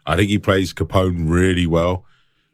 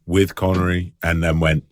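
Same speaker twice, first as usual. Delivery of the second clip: ordinary talk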